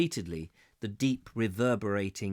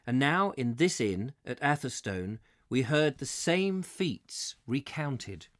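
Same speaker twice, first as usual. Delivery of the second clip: usual speaking voice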